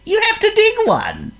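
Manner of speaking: very high squeaky worm. Acted.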